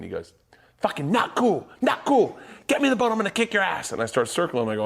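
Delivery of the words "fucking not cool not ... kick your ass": high, indignant imitation of a furious friend; high-pitched mock rage; mock fuming. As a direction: holler threats as a fuming man in the water. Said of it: "he's fucking fuming".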